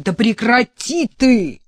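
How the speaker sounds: Vitaliy yells at the sobbing prophet; shouting at him. Acted. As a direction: cut him off with a yell. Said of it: "прикрикнул на него белобрысый Виталий".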